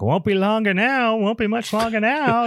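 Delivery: high, loose Maine-accent old-timer impression; hammed-up Maine accent, held high throughout; Judd. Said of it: "Judd just keeps going".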